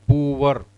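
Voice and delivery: "poor" with a clear v-like w, POO-var; "poor" drawn out with a sounded final r; common mispronunciation. Shown as a demonstration. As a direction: say "poor" as two heavy syllables, POO-var. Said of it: "Most of us say it as poor".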